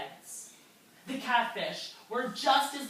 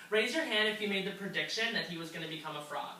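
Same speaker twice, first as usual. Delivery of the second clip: usual speaking voice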